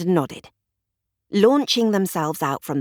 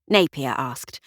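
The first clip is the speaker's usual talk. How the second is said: ordinary delivery, nothing marked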